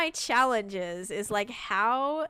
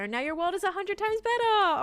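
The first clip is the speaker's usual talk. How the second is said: much higher, louder gushing; excited; 11 semitones higher, 6 dB louder than usual. Acted. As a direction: gush like a proud fan. Reading viewer comment, excited.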